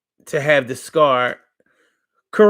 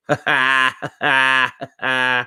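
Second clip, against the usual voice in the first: staccato laugh. Pretend. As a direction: laugh in three separate bursts. Laughs at own mock questioning.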